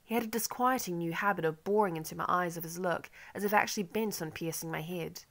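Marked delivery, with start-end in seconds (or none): none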